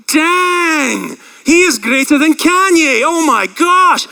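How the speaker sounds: hammed-up awe, high and drawn out; amazed onlookers. Imagined amazed onlookers at baptism.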